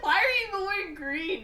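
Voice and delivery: high, loud exasperated question; mock outrage. Sister outraged at brother's sweater.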